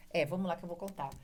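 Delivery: ordinary talk, nothing marked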